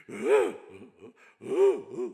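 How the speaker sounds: loud, drawn-out mock wail; imitated wailing. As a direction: wail out like someone sobbing bitterly. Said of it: "통곡 소리가 나는 거예요".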